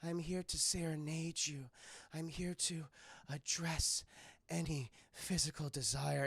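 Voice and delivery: soft, low, breathy temptress; lightly voiced seductress, quiet; sultry Jade. Jade rubbing up on Liu Kang, soft sultry voice.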